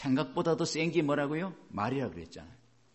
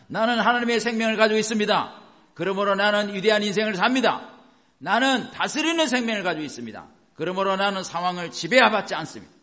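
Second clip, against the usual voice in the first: high, emphatic chant-like declaration; loud proclamation; 7 semitones higher, 7 dB louder than usual. Shown as a demonstration. Preacher leading congregation to declare together, loud proclamation. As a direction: proclaim each line firmly and loudly. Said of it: "이렇게 여러분 선포하라고요".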